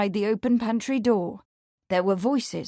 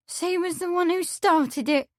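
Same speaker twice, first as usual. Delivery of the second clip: higher, tearful maid's voice; young woman, teary; 9 semitones higher than usual. A narrator's reading. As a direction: voice her as a hurt young maid. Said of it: "She gave another sob".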